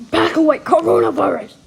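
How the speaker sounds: loud shoo; shouted warning. Acted. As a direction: yell at them to back off. Shooing another player away.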